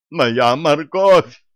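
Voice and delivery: slow, loud gasp of dismay. A narrator's reading. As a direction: gasp it out in shock. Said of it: "ахнула бабуля".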